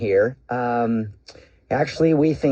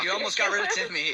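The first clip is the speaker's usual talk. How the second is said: high, laugh-filled words; laughing tease; 6 dB quieter, faster than usual. Real, not acted. Host teasing fooled caller about Timmy, laughing. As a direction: tease through real laughter, pitched up.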